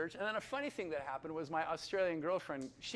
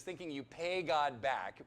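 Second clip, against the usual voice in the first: ordinary delivery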